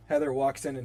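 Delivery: ordinary talk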